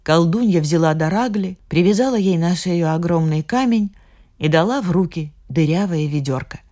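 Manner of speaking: ordinary delivery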